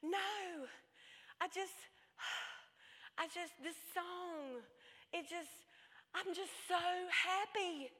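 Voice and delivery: imitating a tearful child, high and halting; little girl fighting happy tears, with sighs and breaks; choking back tears. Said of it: "choked back her tears".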